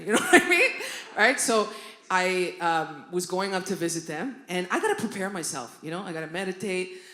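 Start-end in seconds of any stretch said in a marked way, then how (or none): none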